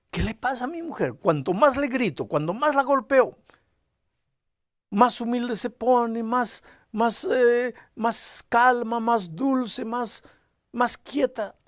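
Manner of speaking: a wife-beater who can't understand her calm; baffled husband. Retold or imitated. Husband wonders aloud why his wife stays gentle, loud and baffled. Husband puzzled by wife's meekness.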